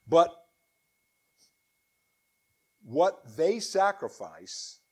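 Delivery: solemn, declaiming Bible reading; scripture reading. Acted. Preacher reading Isaiah to his congregation, solemn declaiming. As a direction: proclaim the words of the Lord to the congregation. Reads Corinthians verse.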